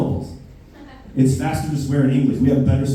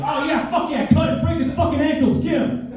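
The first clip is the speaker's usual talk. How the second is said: much higher, shouted cheering; cheering scream. Retold or imitated. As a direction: yell like a Super Bowl fan. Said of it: "I'm screaming like I'm watching the Super Bowl".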